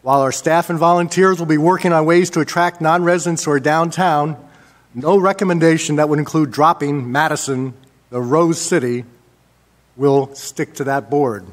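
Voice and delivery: ordinary delivery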